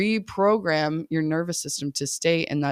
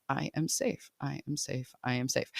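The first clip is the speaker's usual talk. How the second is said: soft, calm repetition, getting quieter; soothing mantra; 2 semitones lower, 8 dB quieter than usual. Shown as a demonstration. Coach showing parents a calming mantra, soft and soothing. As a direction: say it to yourself to settle down.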